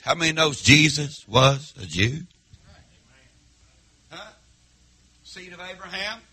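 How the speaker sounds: ordinary talk, nothing marked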